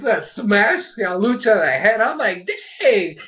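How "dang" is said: high, loud "dang"; impressed exclamation. Retold or imitated. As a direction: exclaim it loud, pitched up, blown away. Acted reaction to boulder smash.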